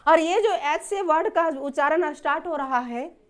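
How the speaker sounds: ordinary talk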